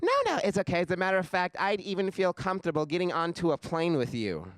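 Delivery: high, gushing girl impression; clueless chick. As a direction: reassure him cluelessly, pitch jumping up. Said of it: "She goes".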